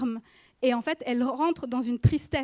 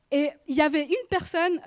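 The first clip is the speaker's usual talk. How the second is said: ordinary talk, nothing marked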